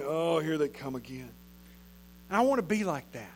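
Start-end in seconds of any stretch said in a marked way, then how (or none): 0.0-1.3 weary sigh. Pretend. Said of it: "You never see him going".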